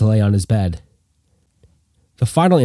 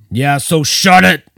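put-on slow, higher, louder drunk yell; 6 semitones higher, 3 dB louder, slower than usual. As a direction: snap it out before rolling over. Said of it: "Coca yelled out".

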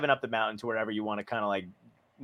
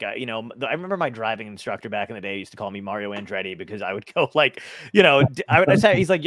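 usual speaking voice

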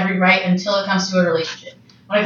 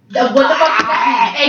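outraged shout; 3 semitones higher, 3 dB louder than usual. Real, not acted. Outraged at his reply.

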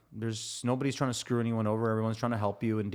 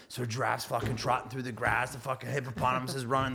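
ordinary talk

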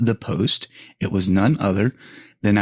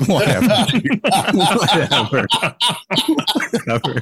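higher, laughing through the brush-off; laughing whatever; 10 semitones higher than usual. Retold or imitated. Caught prankster brushing off her friend, laughing. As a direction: shrug it off while cracking up. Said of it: "I'm like".

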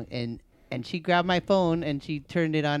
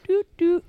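put-on toddler tapping noises. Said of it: "just went in and went".

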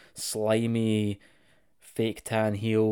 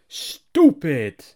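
stupid; 2 semitones higher, 8 dB louder than usual. Real, not acted. Mocking people who think Kong is bear.

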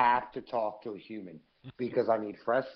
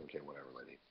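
dismissive mutter; 9 semitones lower, 17 dB quieter, much faster than usual. Retold or imitated. Brushing off the allergic lady.

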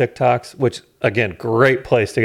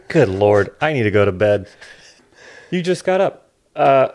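ordinary talk, nothing marked

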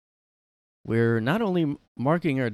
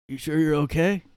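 mock Biden; 6 semitones higher, 5 dB louder than usual. Repeating Biden's line.

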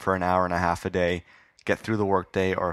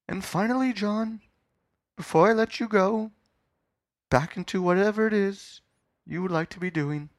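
interviewer character; 10 semitones higher than usual. Acted. James putting the final legacy question, grandly. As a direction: space out each phrase, high and portentous.

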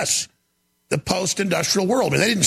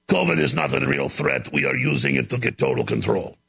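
low mock Russian accent, villain-like; 5 semitones lower than usual. Host mocking Schwab's plan in a low Russian accent. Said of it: "It's more of a Russian accent".

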